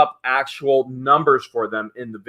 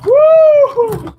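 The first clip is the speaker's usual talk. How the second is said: mock cheer; 11 dB louder than usual. Mock cheer in email to George.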